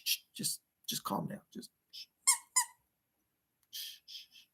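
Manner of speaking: soft whispered shh to calm him down; whispered shushing. Real, not acted. Hushing the overexcited puppet.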